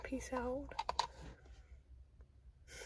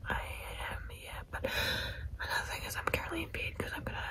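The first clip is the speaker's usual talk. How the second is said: late-night bedtime whisper; hushed so no one wakes; 5 semitones higher, 5 dB quieter than usual. Real, not acted.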